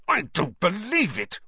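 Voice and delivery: a high, sharp burst of outrage; seething disbelief at the intruders; outraged. A narrator's reading. Captain seeing rivals take his pitch, outraged. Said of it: "Wood hissed in outrage".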